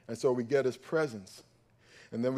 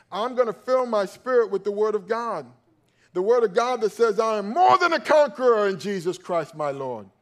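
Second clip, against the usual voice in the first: loud, high, driving preacher's build; a pastor rallying the congregation, loud and high; preaching at full force; 5 semitones higher, 10 dB louder than usual. Acted. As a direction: lift it and preach it hard.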